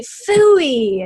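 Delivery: phooey popped out high and loud; playful exclamation. Shown as a demonstration. Parent modelling a fun word for a frustrated child, high bright phooey. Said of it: "and that you say".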